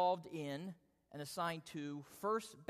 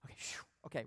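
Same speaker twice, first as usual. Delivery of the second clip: relieved breath; 5 semitones lower, 5 dB quieter, much faster than usual. Retold or imitated. Relief after Haddon's compliment.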